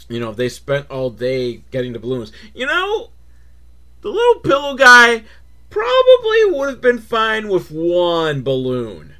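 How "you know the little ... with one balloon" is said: hammed-up children's host; put-on kids-show voice. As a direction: talk like a chirpy children's host.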